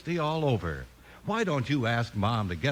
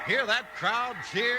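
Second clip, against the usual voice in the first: calling out; 8 semitones higher than usual. Acted. Announcer calling over crowd.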